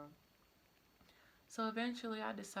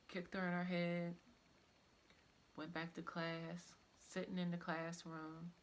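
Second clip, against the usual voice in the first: ordinary talk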